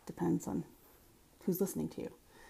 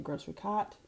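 "cot" for cart, r dropped in Boston style; Boston-accented "grocery cot", no r; quick Boston "grocery cot"; 2 semitones higher, 4 dB quieter than usual. Shown as a demonstration. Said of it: "or in Boston".

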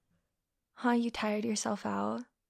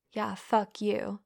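usual speaking voice

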